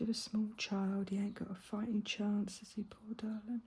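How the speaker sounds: teasing herself in a breathy hush; hushed mock commentary. Crafter mocking herself to viewers in a whisper. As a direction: narrate your own folly under the breath.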